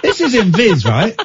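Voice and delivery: high, laughing disbelief; incredulous. Real, not acted. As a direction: squeal in amused disbelief. Incredulous at the silly story.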